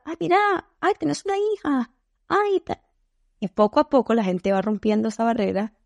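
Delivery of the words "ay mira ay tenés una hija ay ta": much higher sweet chatty voice; gushing small talk. Retold or imitated. Chatting up a tough client, much higher gushing "Ay, mira". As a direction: coo over their kid to break the ice. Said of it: "empezás a".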